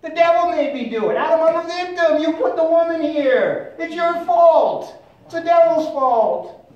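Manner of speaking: hammed-up victim's complaint; playing the victim. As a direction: cry out the blame in exaggerated protest. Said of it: "she's basically playing the victim game".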